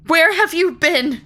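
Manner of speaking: high, loud cry of shock; Molly cries out at the long-lost unicorn. Acted. Molly cries out at the unicorn, loud and high. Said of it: "she cried".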